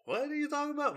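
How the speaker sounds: high-pitched baffled question; confused disbelief. Retold or imitated. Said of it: "that weird, confused".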